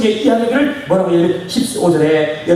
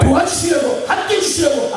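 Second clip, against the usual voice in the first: ringing proclamation; 9 semitones higher than usual. Shown as a demonstration. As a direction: proclaim it boldly to the whole church. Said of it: "도와주시려고 repeated".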